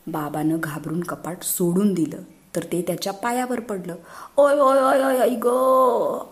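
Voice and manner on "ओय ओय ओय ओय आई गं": howling ouch, higher and louder, dragged slow; loud cry of pain. Acted. Father crying out as cupboard falls on foot. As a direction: yelp as the cupboard hits your foot. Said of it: "बाबा मोठ्याने ओरडला".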